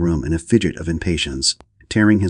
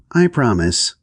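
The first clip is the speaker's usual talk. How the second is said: blithely; 6 semitones higher, slower than usual. A narrator's reading. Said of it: "said Titania blithely".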